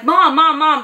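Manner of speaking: imitating a frantic call through a numb mouth. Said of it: "it just sounds real crazy".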